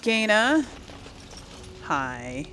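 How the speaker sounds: ordinary talk, nothing marked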